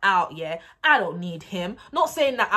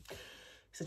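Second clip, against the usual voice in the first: usual speaking voice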